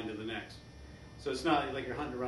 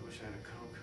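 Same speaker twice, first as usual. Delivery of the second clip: quiet; 9 dB quieter, faster than usual. Shown as a demonstration. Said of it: "free association".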